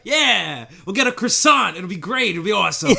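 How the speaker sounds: mock cheery hero.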